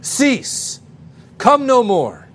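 high, forceful kingly commands, each on its own; yelled command. Acted. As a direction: call it out like a king ordering back the sea. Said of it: "he began to yell".

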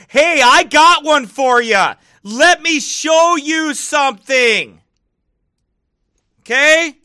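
high, slow hammed-up holler; loud proclaiming. Showing viewers Bible proof, hammed-up proclaiming. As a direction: call it out slowly, very loud.

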